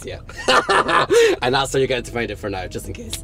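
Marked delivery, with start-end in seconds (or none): 0.2-1.4 shrieking laugh; loud high laugh. Real, not acted. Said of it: "It kinda makes me laugh".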